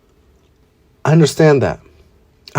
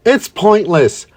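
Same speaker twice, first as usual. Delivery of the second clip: villain's boast; 7 semitones higher than usual. Acted. Villain boasting of his creature.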